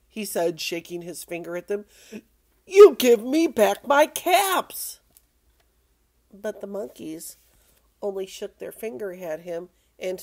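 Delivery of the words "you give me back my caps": loud, slow, high demand; storybook peddler scolding at full volume; angry peddler. Acted. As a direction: demand it word by word, loudly. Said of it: "made the peddler very angry".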